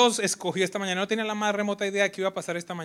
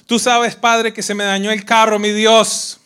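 loud, hammed-up pleading prayer to God; manipulative prayer; 7 semitones higher, 19 dB louder, slower than usual. Manipulator praying aloud in church, hammed-up pleading. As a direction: overplay a fishing-for-favours prayer. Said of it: "los otros manipuladores".

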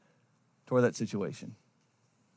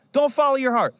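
"Don't" higher and louder, hammered home; emphatic; 8 semitones higher, 5 dB louder than usual. Shown as a demonstration. Pastor warning congregation against feelings, emphatic louder. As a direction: preach "Don't follow your heart" with full force.

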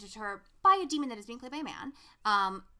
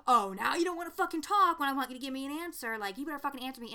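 mock angry demand, louder and higher; pissed off; 4 semitones higher, 5 dB louder, faster than usual. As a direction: act out his fed-up outburst. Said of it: "he's so pissed off".

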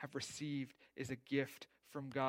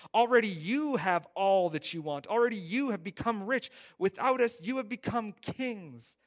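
loud, higher, mocking sarcasm; sarcastic; 6 semitones higher, 10 dB louder than usual. Preacher reading Paul mocking the Corinthians, sarcastic. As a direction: read Paul's words dripping with mock praise. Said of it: "you can see the sarcasm here".

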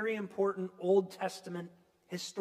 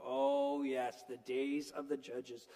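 knowing sigh; 7 semitones lower, 4 dB quieter, slower than usual. Retold or imitated. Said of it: "you're supposed to go".

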